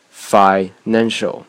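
"financial" very slowly, with a long stop after "fi", then "nan"; pause before stress. Shown as a demonstration. Teacher giving a practice word, "financial" split by a pause. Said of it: "可以多拿几个单词练练".

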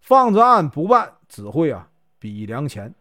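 ordinary delivery, nothing marked

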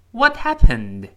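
WHAT and HAPPENED both hit hard, high and loud; high, loud stress on each word. Shown as a demonstration. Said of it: "这两个单词都重读".

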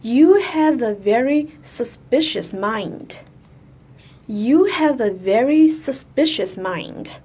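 slow, careful teacher's read-aloud; model sentence reading. Shown as a demonstration. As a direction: read it slowly for learners to follow. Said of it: "我们继续看下一个句子". (慢速示范朗读)

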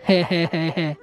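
low "hey-hey" chuckle; monk's chuckle. A narrator's reading. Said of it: "和尚嘿嘿一笑说".

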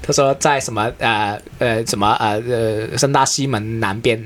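ordinary talk, nothing marked

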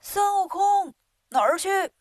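high calling shout. A narrator's reading. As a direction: call across to Sun Wukong. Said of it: "喊孙悟空". (高声呼喊)

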